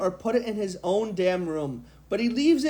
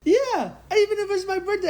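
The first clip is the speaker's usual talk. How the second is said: silly high voice; 13 semitones higher than usual. Pretend. Joking in a put-on voice.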